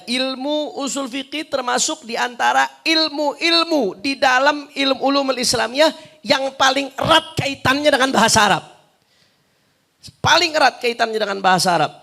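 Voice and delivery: high, loud lecturing voice; forceful, insistent teaching; emphatic preaching. Real, not acted.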